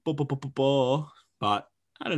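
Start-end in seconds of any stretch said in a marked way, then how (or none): 0.0-1.1 lightly voiced mock complainer, a bit louder; a hint of a moaning know-it-all; mocking critics. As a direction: parrot the whiners dismissively. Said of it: "everyone would have been like".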